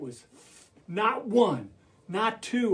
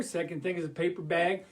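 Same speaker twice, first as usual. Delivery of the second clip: ordinary delivery, nothing marked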